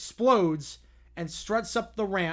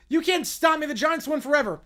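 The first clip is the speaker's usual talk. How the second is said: shouted character line; 7 semitones higher, 6 dB louder than usual. Acted. Character shouts in read story.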